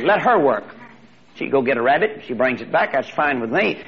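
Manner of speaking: ordinary delivery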